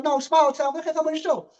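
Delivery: high, fast and pushy asking; eager coach voice. Retold or imitated. Host imitating the coach begging to come on the show, high and fast. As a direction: rattle it off like an impatient coach. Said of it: "He said".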